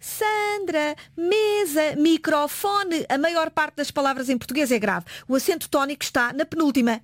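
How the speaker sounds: ordinary delivery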